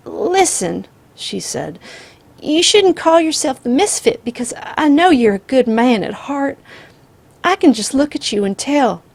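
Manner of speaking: put-on high, loud desperate old woman; frantic flattery. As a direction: flatter him in panic. Grandmother pleading.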